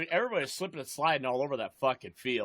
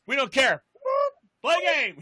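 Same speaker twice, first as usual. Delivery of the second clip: much higher, louder mock referee brushing off the complaint; mock dismissive ref; 9 semitones higher, 6 dB louder than usual. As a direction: wave the complaint away like a careless ref.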